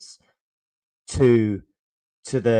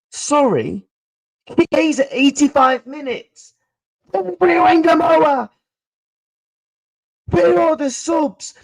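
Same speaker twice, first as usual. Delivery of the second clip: higher, strained, exasperated shouting at the manager; exasperated rant; 11 semitones higher, 4 dB louder than usual. Real, not acted. Streamer ranting at the manager's lack of subs, high and exasperated. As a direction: vent disbelief, pitched high.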